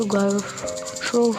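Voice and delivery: usual speaking voice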